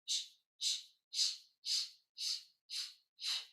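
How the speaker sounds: gentler unvoiced shh bursts; softened shh. Shown as a demonstration. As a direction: ease off each shh. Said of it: "Notice that I soften".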